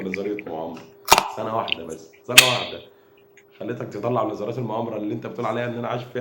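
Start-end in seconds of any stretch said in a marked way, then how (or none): 1.4-2.8 second سنة واحدة suddenly much louder; emphatic shout. Acted. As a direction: hammer the words سنة واحدة home. Mocking rival, repeating for emphasis.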